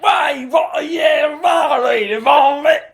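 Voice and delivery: high, booming stage shout; bully the space, belt it out obnoxiously; hammed-up loud monologue. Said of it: "the loudest human being in the history of the world".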